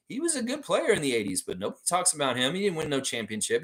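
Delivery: usual speaking voice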